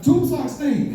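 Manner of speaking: high-pitched, naive insistence; insistent repeat. Retold or imitated. Teenager repeating his order to waitress, naively insistent. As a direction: repeat it way up high, as if it were obvious.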